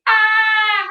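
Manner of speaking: a short startled shriek; scream. Acted. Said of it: "both looked at each other and screamed".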